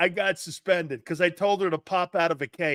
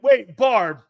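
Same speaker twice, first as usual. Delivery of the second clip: normal talking voice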